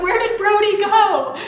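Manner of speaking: high, loud panicked call; calling out for a missing son; retold panic. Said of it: "I'm kinda, like, panicking".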